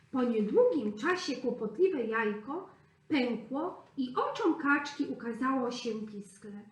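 ordinary delivery

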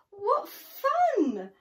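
high, giggling delight; giggly. Acted. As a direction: squeal it with a giggle. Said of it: "giggled Smiley Shark".

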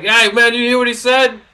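high, loud mock woke student; mock outraged student. Mocking liberal students gossiping, high and loud. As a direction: ham up an outraged classmate. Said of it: "they're like".

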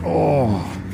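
strained, hauling something heavy; straining under the weight; carrying heavy load. Real, not acted. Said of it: "Yeah, this is heavy".

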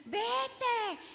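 rising insistent inner command; inner voice urging. Retold or imitated. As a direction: let the blood's urge push out. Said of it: "en mi sangre me está diciendo".

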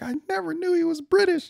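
very high, squeaky astonished voice; hammed-up shocked fan; surprised viewer. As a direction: gasp it out in falsetto disbelief. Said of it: "You're like".

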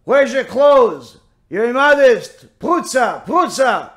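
loud, high parrot tirade; scolding parrot. Acted. Parrot rebukes the woman again next day, loud high mock scolding. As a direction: hurl each insult like a repetitive parrot, drawing some out.

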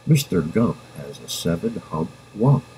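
plain reading voice

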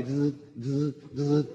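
soft buzzing zzz; short-circuit buzz. Retold or imitated. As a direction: buzz like wires crossing. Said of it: "cruzarse los cables". (zumbido suave de cables cruzados)

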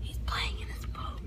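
whispered aside; whispering. Real, not acted. Whispering in the store.

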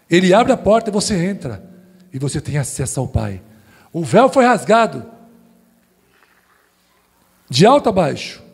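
normal talking voice

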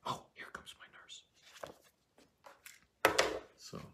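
hushed aside, breathy and unvoiced; whisper. Juliet spotting her nurse in a whisper. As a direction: drop to a secret whisper.

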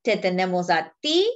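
plain reading voice

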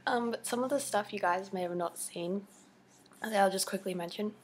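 ordinary delivery

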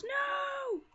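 long, high, loud cry of protest; dismayed shout. Real, not acted. Player losing ground in a battle, dismayed shout.

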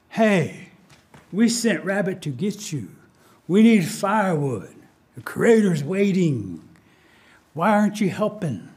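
lightly disguised rabbit voice, drawn-out words; disguised rabbit, demanding. Acted. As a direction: stretch the words as a masked rabbit chiding. Masked rabbit poses as little person.

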